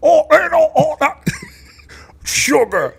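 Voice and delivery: high creature voice through laughter. Acted. Answering the Edgar comparison, hammed-up impression. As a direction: groan it out, laughing.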